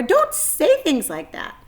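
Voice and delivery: high, loud pained groan; groaning plea. A narrator's reading. Disgusted pig groaning at the spider. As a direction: beg her to stop. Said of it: "groaned Wilbur".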